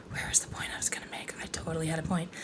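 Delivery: hushed, barely voiced aside; muttering to herself. Real, not acted. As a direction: think aloud under your breath, searching. Losing her train of thought, to herself.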